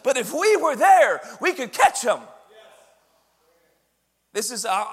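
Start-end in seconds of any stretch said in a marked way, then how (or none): 0.0-2.2 loud, high, emphatic sermon delivery; fired-up preacher driving each phrase home; military-style preaching. Real, not acted. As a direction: preach it at full force, like addressing troops. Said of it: "preach like I'm in the military".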